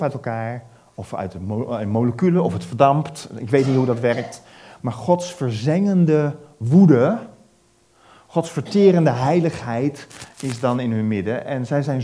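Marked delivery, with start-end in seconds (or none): 4.8-7.2 slow, loud, higher stress on verzengende woede; emphatic preaching. Acted. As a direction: hammer each word of verzengende woede slowly. Preacher stresses God's wrath. (langzaam en luid nadruk op verzengende woede)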